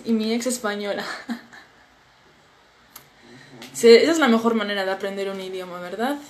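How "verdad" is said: ordinary talk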